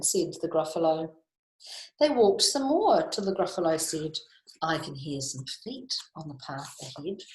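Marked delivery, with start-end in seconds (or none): 4.6-7.2 hushed, suspenseful monster line; much softer storybook suspense; wary listening. Acted. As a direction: murmur it softly, listening out. Gruffalo listening in the wood.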